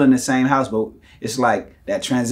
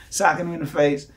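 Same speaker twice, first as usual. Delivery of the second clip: normal talking voice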